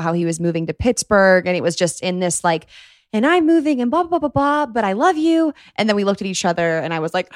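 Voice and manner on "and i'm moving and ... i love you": high-pitched rambling retelling, blurting the last words; high, mock-flustered blurt; stressed boyfriend imitation. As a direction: imitate a stressed partner babbling away in a raised pitch. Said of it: "we were stressed, talking about".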